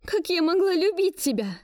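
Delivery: high, bitter cry; bitter outburst. Acted. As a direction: fling it in anger. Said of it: "бросила я в сердцах".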